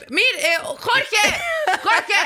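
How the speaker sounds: calling a name down the street, drawn out and high; mock street call with a cracking voice. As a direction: call after someone from behind, slow and stretched. Strangers calling after her in street.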